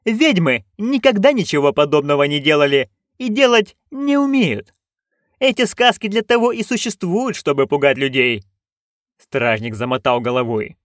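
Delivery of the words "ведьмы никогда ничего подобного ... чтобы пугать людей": high-pitched haughty noblewoman; imperious duchess, dismissive. Acted. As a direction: lecture the guards as a scornful duchess. (надменная, раздражённая герцогиня)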